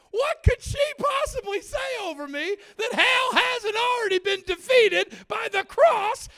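high, loud preaching shout; impassioned outburst. Retold or imitated. Preacher scoffs at being cursed, impassioned shout. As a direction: build to a shouted hallelujah peak.

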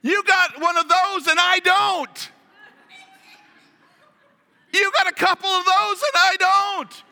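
mock astonished. Adam and Eve comparing bodies, mock astonished and loud. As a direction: play up the couple's comic surprise.

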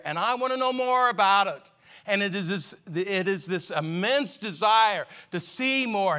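rising, loud, fervent sermon delivery; impassioned preaching. Acted. Preacher urging the congregation toward desire, in full impassioned preaching. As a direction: preach it with building urgency.

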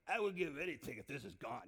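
low, stuffed-up pleading; head-cold voice. Acted. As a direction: plead through a bad cold. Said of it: "talking like this".

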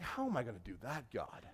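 soft, sinking plea; quietly baffled appeal to God; mild dismay. Retold or imitated. Retold dismayed question to God.

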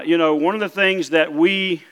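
usual speaking voice

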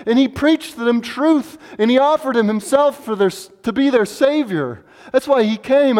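impassioned pulpit climb; preaching with fervor. Acted. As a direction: press the rhetorical questions home.

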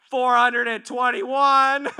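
high, drawn-out holler; loud comic announcement of a score; shouted tally. Acted. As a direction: call out the running count at full volume. Acted score-keeper calling out the tally.

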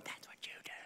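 quick hushed whisper; whispered aside. Real, not acted. Preacher teasing the congregation, in a whisper. Aside about people poking.

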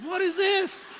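high, loud cry of shocked disbelief; startled scream. Retold or imitated. Crew leader shocked at the kid on the toilet, startled scream. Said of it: "I started scr".

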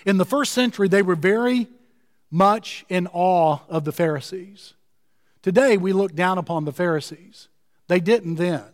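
usual speaking voice

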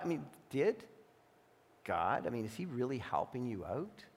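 soft, low, insinuating tempter; quiet serpent voice sowing doubt; tempter's whisper. Acted. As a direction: coax him like a sly voice in his ear. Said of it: "that voice whispering in your ears".

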